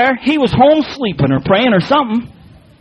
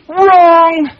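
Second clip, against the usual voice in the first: raised, drawn-out cry of wrong; emphatic rebuttal; 6 semitones higher, 4 dB louder than usual. Real, not acted. Preacher rejecting the verse he just read, emphatic rebuttal. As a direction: call it out like a buzzer verdict.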